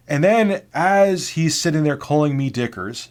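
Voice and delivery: ordinary delivery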